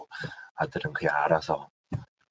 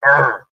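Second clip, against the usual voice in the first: loud, higher "argh"; frustrated growl; 9 semitones higher, 11 dB louder than usual. Retold or imitated. Host recalling the rain, loud frustrated growl. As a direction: groan loudly at the weather. Reacting to rainy weather.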